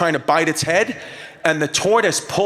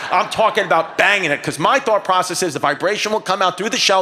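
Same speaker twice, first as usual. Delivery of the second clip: ordinary delivery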